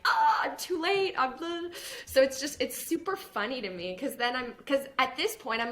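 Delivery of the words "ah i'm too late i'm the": high, hammed-up ah, I'm too late; mock panicked buyer. Latecomer crying out that they're too late, hammed-up. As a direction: whine it like missing the boat.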